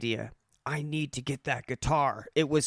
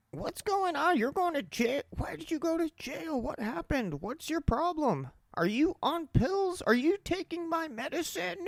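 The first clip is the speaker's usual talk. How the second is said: much higher mock-frantic interrogation; crazy roommate. Crazy roommate grilling him about jail, high mock-frantic voice. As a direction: squeak out her paranoid questions. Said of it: "this crazy lady".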